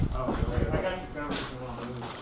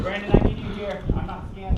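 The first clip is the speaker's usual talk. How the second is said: loud call across the field, pitched up; 6 semitones higher, 6 dB louder than usual. Real, not acted. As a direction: shout to a teammate. Calling teammate during firefight.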